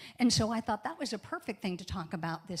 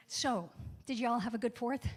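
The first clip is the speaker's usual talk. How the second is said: normal talking voice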